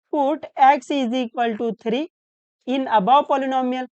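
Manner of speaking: slow dictation. Shown as a demonstration. Teacher dictating a step.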